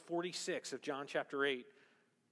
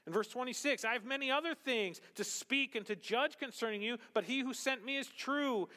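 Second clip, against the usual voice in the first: high, ringing sermon delivery; impassioned preaching; 12 semitones higher, 4 dB louder than usual. Acted. Pastor reading Jesus's words to the church, impassioned preaching. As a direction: press the words of Jesus on the listeners.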